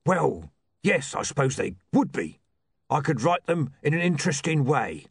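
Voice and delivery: put-on undead voice, conceding; concede, then pitch yourself as a zombie writer; zombie Mister Bendy.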